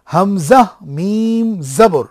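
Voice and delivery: হামজা মিম জবর spelled out very slowly; slow spelling demo. Shown as a demonstration. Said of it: "আপনারা আমার সঙ্গে পড়ুন".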